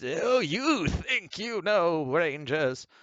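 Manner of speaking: slow, high mocking villain taunt; shocked villain. Villain taunting the Rangers, slow high sneer. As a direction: sneer it out slowly at the heroes. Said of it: "the shocked".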